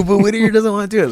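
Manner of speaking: high, loud and fast whiny objection; a sharp high jab of disbelief; mocking protest. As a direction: squeak it out fast in mock exasperation. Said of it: "I go".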